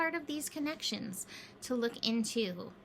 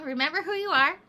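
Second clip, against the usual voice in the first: louder, higher, emphatic reminder; 4 semitones higher, 6 dB louder than usual. Shown as a demonstration. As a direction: lift it with emphasis. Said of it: "Oh, we're back to that, huh?".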